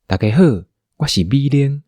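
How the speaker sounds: ordinary talk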